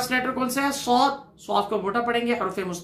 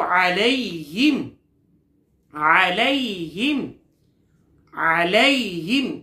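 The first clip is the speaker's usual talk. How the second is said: drawn-out tajweed recitation; slow chanted recitation; slow Quran recitation; 3 semitones lower, much slower than usual. Shown as a demonstration. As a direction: stretch the word for students to echo. Teacher recites word.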